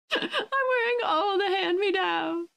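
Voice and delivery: high, drawn-out pretend sob; mock whimper. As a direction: whine it slowly like a pitiful poor child.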